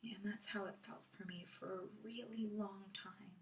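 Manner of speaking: very soft, near-whispered admission; quiet, inward admission; subdued confession. Acted. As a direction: drop to a hush, barely voiced. Subdued confession after the nightmare.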